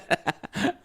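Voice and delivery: high-pitched peal of laughter. Real, not acted. Amused by viewers' comments, high laughter. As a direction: crack up in a high giggle.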